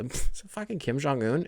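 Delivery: stunned mutter. Retold or imitated. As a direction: breathe it out, amazed. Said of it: "a nasal exhale".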